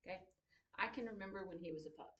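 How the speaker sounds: ordinary delivery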